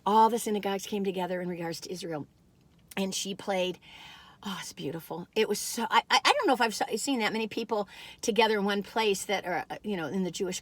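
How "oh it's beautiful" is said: soft, whispered awe; whispering how beautiful the synagogue service was; hushed awe. Real, not acted. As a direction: breathe it out, moved.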